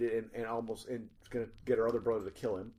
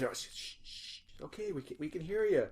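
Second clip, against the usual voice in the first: ordinary delivery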